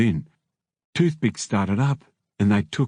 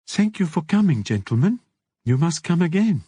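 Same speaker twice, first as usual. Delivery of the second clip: put-on high-pitched, accented hostess voice; accented madam; 8 semitones higher than usual. A narrator's reading. Madam thanking departing customers, high accented voice. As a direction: play a courteous madam in a high voice.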